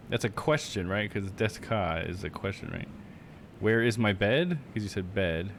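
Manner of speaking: ordinary delivery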